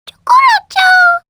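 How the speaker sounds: very high, loud squeaky creature call; cute squeaky critter cry; chao chirp. Acted. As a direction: chirp like a tiny pet creature. Chao creature cries its name.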